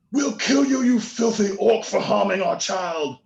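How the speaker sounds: loud, very high in-character yell; angry yell. Acted. Villager to the orc who harmed their child, yelling. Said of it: "and he yells at you".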